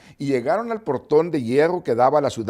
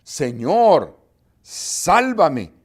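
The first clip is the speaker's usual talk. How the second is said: louder, rising desperate plea; cry for help; 3 semitones higher, 6 dB louder, much slower than usual. A narrator's reading. Pedro sinking, crying out to Jesús, louder. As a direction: call out as if sinking. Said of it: "Y entonces gritó".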